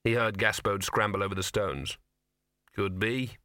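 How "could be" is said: grudging. A narrator's reading. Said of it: "said the dog grudgingly".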